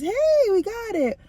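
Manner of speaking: high, bright-sounding relief; mock cheerful news; a jump up for the good news; hopeful quote. Retelling doctors' hopeful news.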